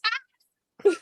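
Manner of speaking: sudden high squeal; high pained yelp. Acted. Struck sun bear yelps, high pained cry. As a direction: squeal like a bear being hit. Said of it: "he goes".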